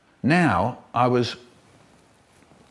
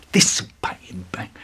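unvoiced revenge whisper; vengeful whisper; 7 semitones lower than usual. Boy whispers revenge while stabbing his brother. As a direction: hiss it through the teeth.

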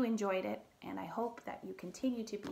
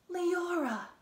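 gasp; 8 semitones higher than usual. Acted. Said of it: "he gasped".

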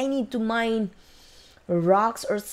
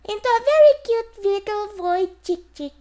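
put-on cooing baby talk; 10 semitones higher, 3 dB louder than usual. Coaxing a hatching egg.

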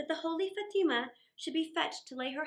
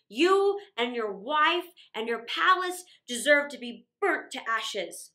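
loud, booming, slowing on the threat; furious genie; 7 dB louder than usual. Acted. Genie scolding Aladdin for the roc's egg, loud fury. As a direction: rage at the ungrateful master.

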